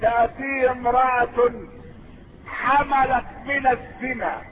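ordinary delivery

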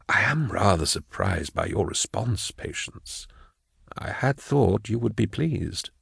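quiet, measured disappointment; smooth official's hushed reproach; soft-spoken prefect. Acted. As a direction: speak softly, slowing at the end. Prefect feigns surprise.

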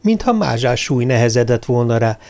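plain reading voice

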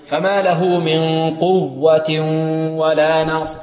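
slow chant, tanwin of قوة melting into ولا with a hum; measured recitation with nasal holds in من قوة ولا; idgham recitation. Shown as a demonstration. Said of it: "هنا ندغم".

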